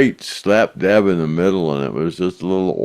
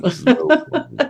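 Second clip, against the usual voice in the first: laughing. Real, not acted. Laughing about the snow woman.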